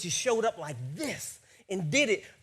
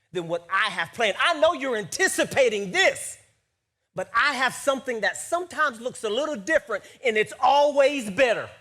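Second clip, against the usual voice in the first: louder preaching, punching key words; emphatic preaching; 4 semitones higher, 7 dB louder than usual. Acted. Preacher voicing God's promise to the congregation, emphatic preaching. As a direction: drive it home with rising force.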